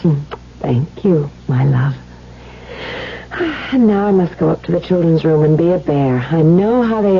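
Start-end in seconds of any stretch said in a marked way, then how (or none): 2.7-3.7 soft sigh. Acted. Wife sighs after tender talk.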